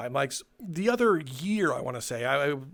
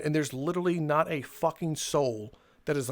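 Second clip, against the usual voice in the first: ordinary talk, nothing marked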